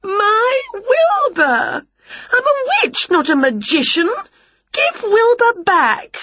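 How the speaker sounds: very high, protesting wail; wailing demand. A narrator's reading. Winnie demands auntie restore her cat, high wail. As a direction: wail each phrase high, stretching the ends.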